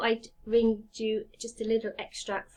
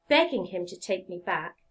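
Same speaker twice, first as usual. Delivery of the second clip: usual speaking voice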